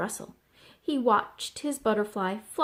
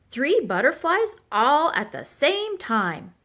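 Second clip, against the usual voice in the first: amazed, higher-pitched gushing; excited quacking; 6 semitones higher, 4 dB louder than usual. A narrator's reading. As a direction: gush in wonder as an impressed duck. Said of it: "Duck quacked".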